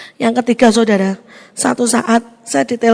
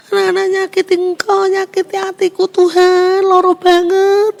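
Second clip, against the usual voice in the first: higher, pained plea to God, slow at the end; hurt lament; 6 semitones higher than usual. Retold or imitated. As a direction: complain to God from a wounded heart. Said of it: "saya bilang begitu". (keluhan pilu kepada Tuhan, nada tinggi)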